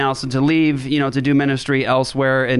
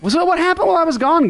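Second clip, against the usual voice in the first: excited; 13 semitones higher, faster than usual. Acted. Playful return to the studio.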